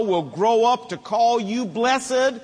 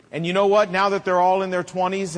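ordinary delivery

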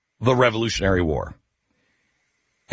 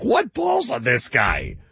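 higher, drawn-out mock amazement; mimicked disbelief; 5 semitones higher, slower than usual. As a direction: imitate someone's astonished reaction, pitched up and slow. Curator mimics host's amazed reaction.

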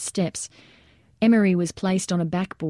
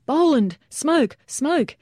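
short, high-pitched calls; urgent yell; 8 semitones higher, much slower than usual. A narrator's reading. Soldier calling to a lieutenant across the roof for cover, high yell. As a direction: yell each word separately, pitched high. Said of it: "Jager yelled to a lieutenant".

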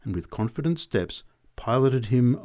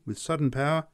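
ordinary delivery, nothing marked

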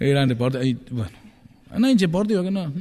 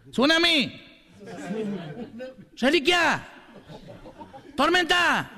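high yelled heckles, laughing through; shouted cheers; 7 semitones higher, 4 dB quieter, much slower than usual. Retold or imitated. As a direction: cry each word up to the stage. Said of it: "le gritaba".

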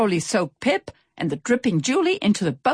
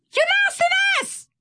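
very high, shrill squeal; excited shout; 4 dB louder than usual. A narrator's reading. Swordfish calls to his captain, shouting excitedly. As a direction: squeak it out to get the captain's attention. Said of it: "he shouted excitedly".

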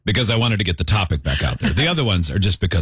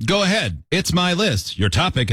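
ordinary talk, nothing marked

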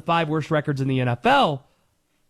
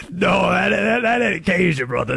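put-on Cajun accent; 3 semitones higher, 5 dB louder than usual. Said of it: "This is Cajun right here".

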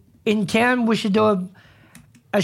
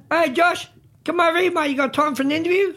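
raised voice, higher and a little fast, calling him over; yelling out to a politician; 7 semitones higher than usual. Retold or imitated. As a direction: call out across the food court to grab his attention. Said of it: "just yell out".

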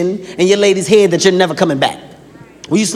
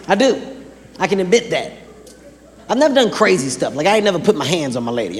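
ordinary talk

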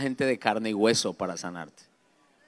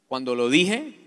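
ordinary delivery

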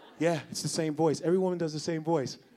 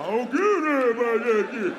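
caveman voice; 6 semitones higher, 4 dB louder than usual. Retold or imitated. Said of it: "same caveman voice".

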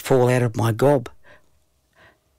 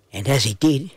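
usual speaking voice

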